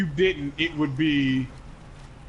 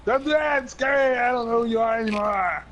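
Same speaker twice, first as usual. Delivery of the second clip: hammed-up wail of alarm, pitched way up; mock alarm; 9 semitones higher than usual. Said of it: "otherwise you'd be like".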